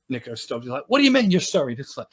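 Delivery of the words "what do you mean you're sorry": high, loud retold challenge; acted-out affronted retort; indignant disbelief. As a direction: demand an explanation, incredulous. Nico confronts the apology.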